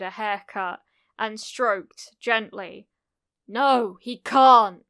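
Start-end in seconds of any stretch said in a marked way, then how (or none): 3.5-4.8 put-on loud, defiant little boy; angry denial. A narrator's reading. Kacchan denies Izuku the seat, loud and angry. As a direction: burst out in loud refusal. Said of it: "Kacchan angrily denied".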